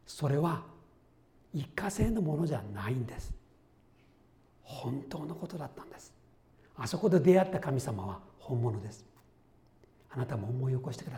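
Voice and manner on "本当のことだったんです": soft, hushed conviction; earnest whisper. Real, not acted. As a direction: whisper the truth intimately. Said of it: "whispered affirmation". (ささやくような確信)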